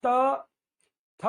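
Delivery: त and थ said alone, loud, tongue tapping the teeth; model tooth sounds. Shown as a demonstration. Teacher asks class to repeat त थ, loud and clear. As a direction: drill त, थ with a clear tooth tap. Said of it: "मेरे साथ बोलिए".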